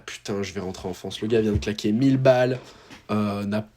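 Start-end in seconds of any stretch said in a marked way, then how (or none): 0.1-1.2 lower, softer, defeated mumble; deflated mock despair. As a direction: imitate the friend giving up, dropping down quiet. Friend giving up after injury.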